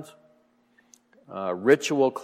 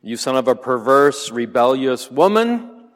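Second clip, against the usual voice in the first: loud but a touch less heated king's insult; re-read angry insult; 3 semitones higher, 8 dB louder than usual. Acted. Teacher repeats Saul's insult to the class, firmly angry. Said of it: "what did you say?".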